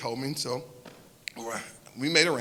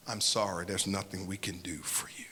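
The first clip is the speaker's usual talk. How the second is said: soft whisper, trailing away; a sorry doctor's hushed whisper; whispered apology; 8 semitones lower, 10 dB quieter than usual. Retold or imitated. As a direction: breathe the words out gently. Doctors say nothing can be done.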